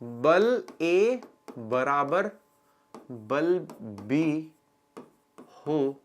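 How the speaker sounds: very slow, drawn-out dictation. Shown as a demonstration. Teacher dictating the condition slowly to students. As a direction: spell it out slowly for notes. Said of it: "teacher dictating slowly".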